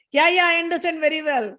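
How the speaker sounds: high-pitched mock indignant comeback; quarrelling retort. Wife answering husband in their fight, angry. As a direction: throw it back in an acted squabble. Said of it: "So the fight is going on".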